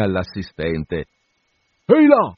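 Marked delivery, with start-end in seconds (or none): 1.9-2.3 richiamo acuto e forte; grido di caccia. A narrator's reading. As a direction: grida vedendo la volpe. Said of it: "gridò d'un tratto". (grido acuto da cacciatore)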